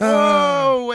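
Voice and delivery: a stunned, amused wow at the beginner's book; laughing disbelief; mock amazement.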